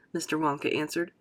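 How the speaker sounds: plain reading voice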